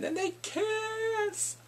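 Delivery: slow, very high swooning squeal; excited squeal. Real, not acted. Telling viewers of the long-awaited kiss, squealing. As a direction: stretch it into a delighted squeal.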